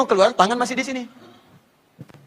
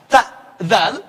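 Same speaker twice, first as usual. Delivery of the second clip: ordinary talk, nothing marked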